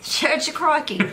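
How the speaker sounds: high, loud hammed-up Aussie; Australian accent. Wife shouting the punchline in an Australian accent. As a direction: belt it like a croc hunter. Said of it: "where did Steve Irwin go to church".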